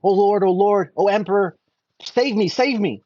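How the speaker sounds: a hint of a tiny frightened fish crying for help; pleading little fish. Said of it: "and the fish says".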